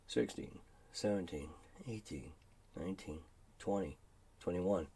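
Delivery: low, soft count to yourself; counting under breath. Real, not acted. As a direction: murmur each number low, evenly spaced. Counting seconds to himself.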